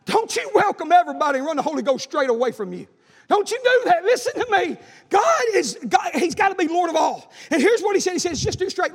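loud, high, driving preacher's cry; preaching at full force. Acted. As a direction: hammer it home to the congregation. Preaching climax again.

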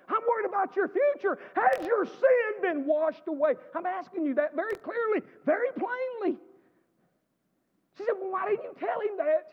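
loud, high preaching at full cry; full-force preaching. Acted. Preacher pressing the congregation about their future, loud and high. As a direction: lift the whole church with a ringing cry.

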